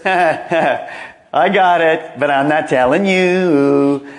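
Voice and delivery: mock gloating, slow and drawn out; hammed-up withholding taunt after a chuckle; God. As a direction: tease like someone keeping a secret, stretching every word. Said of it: "It's not like He sits up there".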